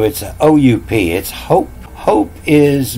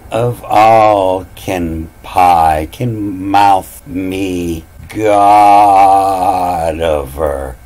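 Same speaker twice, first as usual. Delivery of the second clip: slow drawn-out reading; much slower than usual. Shown as a demonstration.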